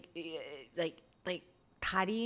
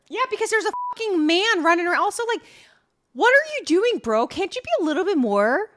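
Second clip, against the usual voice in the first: exasperated rant; 10 semitones higher, 10 dB louder, faster than usual. Real, not acted. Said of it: "fired-up rant".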